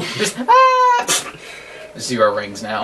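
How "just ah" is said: sudden high yelp of dismay; startled scream. Real, not acted. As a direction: shriek as everything goes wrong. Hit, loses all rings.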